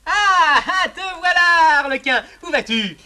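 high, loud call across the stage; hearty greeting shouted to Arlequin; loud hailing. Acted. Valet hails Arlequin loudly.

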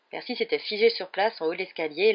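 usual speaking voice

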